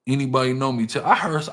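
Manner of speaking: normal talking voice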